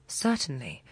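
ordinary delivery

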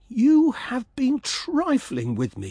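high, slow, hurt outcry; swallow crying out. Acted. Swallow to the reed, slow hurt outcry. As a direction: stretch it out high and wounded. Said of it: "he cried".

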